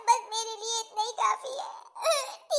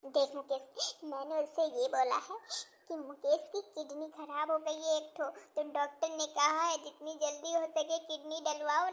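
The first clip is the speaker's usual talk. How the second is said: much softer, low secretive telling; hushed conspiring; 4 semitones lower, 6 dB quieter than usual. Acted. Mother secretly tells her son how she fooled his wife, hushed. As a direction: let him in on the trick quietly.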